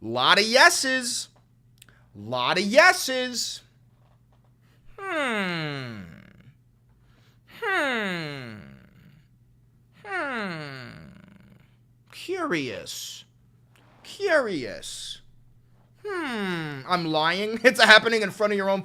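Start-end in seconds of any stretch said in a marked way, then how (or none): 0.0-3.6 slow, drawn-out sarcastic marvel; mock amazement. Real, not acted. 5.0-16.8 mock pondering.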